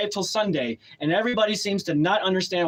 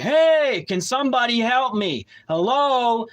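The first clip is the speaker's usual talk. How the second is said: raised, high retold call for help; yelling for help; 6 semitones higher, 4 dB louder, slower than usual. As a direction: call out as if nobody is coming. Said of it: "I was yelling for help".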